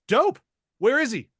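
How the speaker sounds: high, loud, eager blurt; excited quick-fire character quote; Jason. Retold or imitated. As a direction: burst in with keen, clueless enthusiasm. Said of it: "And Jason goes".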